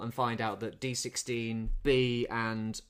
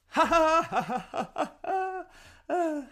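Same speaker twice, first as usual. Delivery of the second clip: high forced "ha ha ha" dying away; sarcastic, drawn-out pretend laughter; mock laugh; 4 dB louder than usual. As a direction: fake a laugh at a lame joke. Mock laugh after retold joke.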